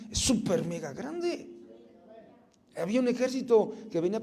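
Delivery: loud, high, rising preacher's proclamation; fiery preaching. Acted. Pastor proclaiming abundance to the congregation, loud preaching. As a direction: proclaim it like a revival pulpit.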